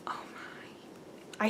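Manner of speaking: awed whisper. Real, not acted. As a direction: breathe it out in wonder. Awed by the yarn colours.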